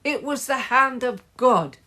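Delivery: high, loud declaration; shouted. A narrator's reading. Henry defending his goal, loud shout. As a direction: proclaim it loudly. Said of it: "shouted Henry".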